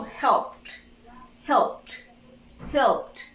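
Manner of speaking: "helped" ending P then a released T; final T sound. Shown as a demonstration. Teacher drilling the simple past of help, final T sound. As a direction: say "helped" with no E, just T. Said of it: "only T".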